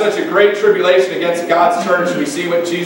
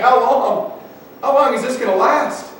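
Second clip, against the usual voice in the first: higher-pitched pleading question, mildly lamenting; 6 semitones higher than usual. Acted. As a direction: ask it as a preacher imitating a lament. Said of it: "It's a lament".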